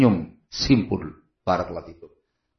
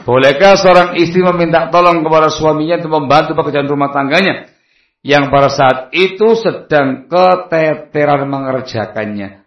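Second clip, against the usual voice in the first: ordinary talk, nothing marked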